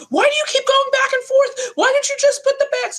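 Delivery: mock exasperated yell. Ex loses it over her chip habit, exasperated mock yell. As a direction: imitate him losing it over the chips. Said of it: "lose it and go".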